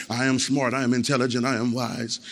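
low, soft, measured affirmations; mock-polite self-affirmation, kept low; nice self-talk. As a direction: recite positive self-talk quietly, mocking it. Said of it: "nice talk".

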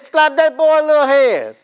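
put-on high, loud bossy old lady voice; mother-in-law's order. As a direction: snap it out like a meddling mother-in-law. Mother-in-law voiced in story.

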